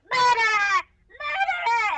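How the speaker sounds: very high, loud drawn-out cry of alarm; hammed-up high-pitched town-crier yell; amplified accusing shout. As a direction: shriek it out for the whole street to hear. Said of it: "use ghost sound to amplify my voice".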